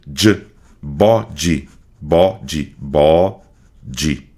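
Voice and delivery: body with the d like dj, bodji, slow; body with a soft j. Shown as a demonstration. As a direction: say body ending in dji. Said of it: "que é o som do J". (body com d molhado, bódji)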